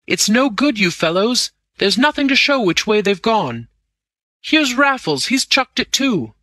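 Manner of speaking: high-pitched, urgent calling; agitated report. A narrator's reading. Crowley reporting the chase has failed, agitated.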